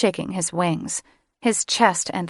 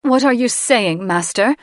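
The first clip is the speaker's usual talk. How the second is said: higher, louder sharp challenge; sharp question; 5 semitones higher, 6 dB louder than usual. Acted. Queen challenging the mage, sharp and loud. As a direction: snap the question at him. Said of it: "Mother asked sharply".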